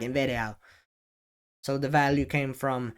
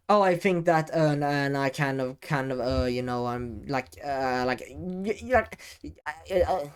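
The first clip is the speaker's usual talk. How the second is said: hammed-up hesitant ramble full of uhs; rambling with fillers. Shown as a demonstration. Coach mimicking a poor speaker, rambling hesitantly. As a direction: stumble and stammer through filler words. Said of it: "Instead of".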